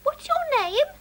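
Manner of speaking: put-on high, small child-mouse voice; little mouse voice. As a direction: ask shyly like a tiny mouse. Little mouse Charlie asks monster.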